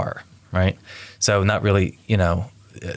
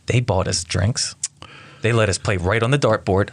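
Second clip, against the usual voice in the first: normal talking voice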